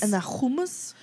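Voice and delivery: "hummus" with an over-authentic throaty h; affected hummus. Acted. Joining the mockery of ordering, over-accented "hummus". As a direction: raise "hummus" into an affected question. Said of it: "just add the, r-, you know".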